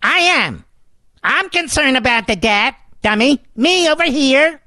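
lightly voiced zany comic calling out; a hint of goofy comedian shouting for attention; Jerry Lewis voice. Host heckling the press secretary in a light comic voice. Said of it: "My best Jerry Lewis voice".

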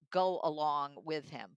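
normal talking voice

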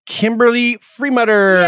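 high, loud, stretched-out guest name; big drawn-out show introduction; announcer introduction. Acted. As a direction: announce the guest like a ringside host.

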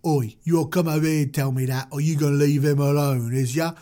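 put-on British street accent. Moses confronting the guard in a rough British accent. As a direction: challenge him like a London tough.

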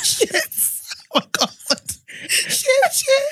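high, squeaky swearing through laughter; laughing to tears. Real, not acted. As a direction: gasp it out, unable to recover. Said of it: "I'm crying".

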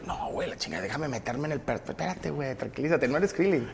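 low, hushed acted aside; imitated low-voiced calming talk; imagined exchange. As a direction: mutter it low and very quietly. Acts a would-be dubber being calmed.

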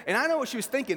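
usual speaking voice

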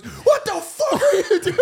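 hammed-up high, fast outburst; retold shocked yell. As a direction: mimic a panicked yell, breaking into laughter. Imitates friend woken and yelling.

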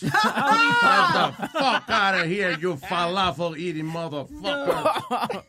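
put-on Southern accent. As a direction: drawl it out like a hammed-up bigot. Mocking racist rant, laughing.

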